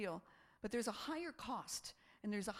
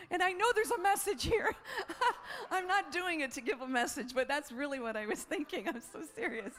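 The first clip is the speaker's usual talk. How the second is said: laughing through it; 8 semitones higher, 6 dB louder than usual. Real, not acted. Laughing while retelling the run.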